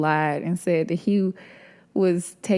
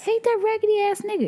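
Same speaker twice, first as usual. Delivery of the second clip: mock side chick. Mocking the jealous side chicks.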